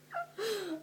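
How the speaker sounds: laughing at herself; a short high giggle. Real, not acted.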